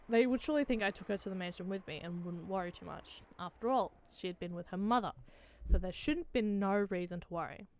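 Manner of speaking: usual speaking voice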